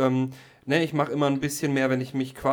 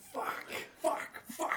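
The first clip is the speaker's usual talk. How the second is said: whispering; 9 dB quieter than usual. Real, not acted. Whispered curse.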